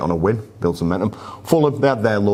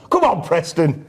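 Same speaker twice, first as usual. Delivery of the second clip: cheering; 10 semitones higher, slower than usual. Real, not acted. Cheering his team on.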